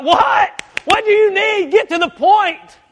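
fed up, cut him off sharply; annoyed wife. Retold or imitated. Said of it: "she would be like".

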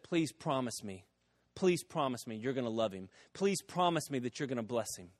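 secretary softly pleading with her boss. Retold or imitated. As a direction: gently beg him to promise.

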